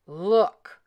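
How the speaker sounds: loud papa bear growl; gruff burst of papa bear; great big bear growl. A narrator's reading. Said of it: "Papa Honey Bear growled in his great big bear voice".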